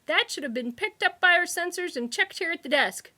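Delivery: high, loud, prim complaint; snippish. A narrator's reading. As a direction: scold him primly. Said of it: "the receptionist said snippishly".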